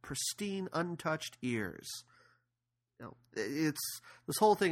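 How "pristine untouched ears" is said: higher, much softer and very slow menace; quiet menace. Retold or imitated. Wesley threatening Humperdinck, drawn out softly. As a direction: savour the last words as a hushed threat. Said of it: "And he goes".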